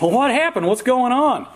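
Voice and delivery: put-on high-pitched kid asking urgently; anxious twin boy. Twin finds his brother hiding in the closet, urgently asking.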